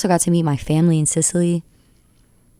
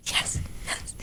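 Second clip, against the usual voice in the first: whispered, overwhelmed yes; breathless whisper; 11 dB quieter than usual. Retold or imitated. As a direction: barely breathe the words out in awe. Star says she'll call her.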